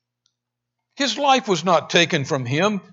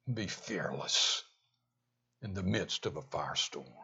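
preacher sinking into a hushed, lower voice about surrender; hushed preaching; 9 semitones lower, 3 dB quieter than usual. Acted. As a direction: drop right down and let each phrase settle reverently. Hushed reverent preaching passage.

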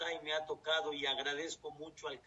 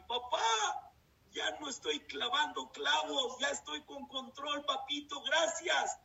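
put-on proud young son; 8 semitones higher, 5 dB louder than usual. Son proudly reports to father.

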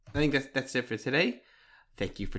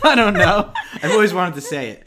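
laughing; 7 semitones higher, 12 dB louder than usual. Real, not acted. Giddy late-night sign-off, laughing.

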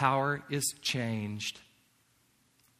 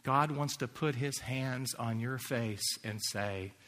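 ordinary delivery

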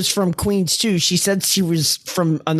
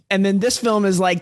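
ordinary talk, nothing marked

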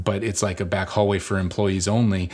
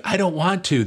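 put-on high-pitched child's protest; imitated balking kid; 10 semitones higher than usual. One of our kids refuses, imitated balking.

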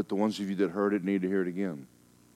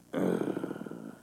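disgusted low groan; 9 semitones lower, 6 dB quieter than usual. Retold or imitated. Reaction to devilish people.

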